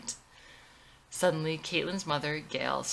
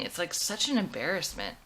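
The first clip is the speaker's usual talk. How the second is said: ordinary talk, nothing marked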